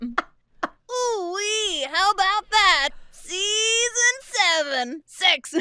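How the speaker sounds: very high, effortful squeezed cartoon voice; clenched throat. Shown as a demonstration. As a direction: clench up and stretch the words out high. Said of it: "I'm clenching".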